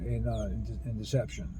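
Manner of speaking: ordinary talk, nothing marked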